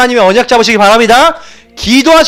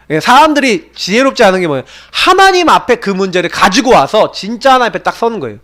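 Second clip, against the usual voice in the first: usual speaking voice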